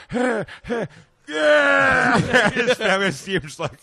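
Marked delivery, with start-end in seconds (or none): 0.1-2.5 dying man's moans. Retold or imitated. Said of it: "He's like".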